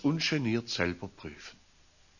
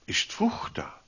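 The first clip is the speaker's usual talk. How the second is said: whisper; 7 semitones higher, 4 dB quieter than usual. Shown as a demonstration.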